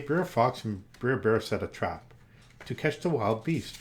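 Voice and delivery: ordinary delivery, nothing marked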